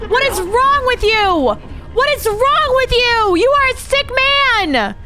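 play-furious, dragging out every accusation; mock outrage. Mock outrage at Z wrecking ship.

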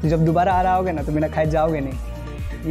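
usual speaking voice

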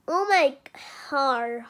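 usual speaking voice

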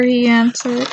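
usual speaking voice

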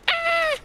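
sudden high-pitched yelp of fright; sharp scared yelp; startled yelp. Real, not acted. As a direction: jump and yelp in surprise. Startled by character.

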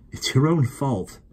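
a brother's mild teasing unconcern; unfeeling. Acted. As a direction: shrug it off without sympathy. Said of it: "said Will unfeelingly".

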